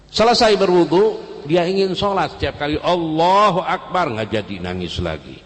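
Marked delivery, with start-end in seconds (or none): 0.1-4.1 high, loud preacher's cry; impassioned preaching. Acted. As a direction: swell into a fervent sermon peak. Preaching at full force.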